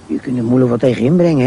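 ordinary delivery

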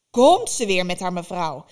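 loud irritated witch outburst; exclaiming witch. Acted. Baba Yaga loudly mocks Vasilisa's polite address, irritated. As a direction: blurt it out, fed up. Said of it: "riep de Baba Yaga uit".

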